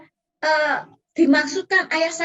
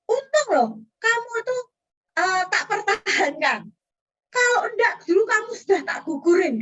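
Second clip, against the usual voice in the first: mother's bitter reproach; 6 semitones higher than usual. Retold or imitated. Mother reminding her child she nearly aborted him, higher and tearful. Mother's hurtful words retold.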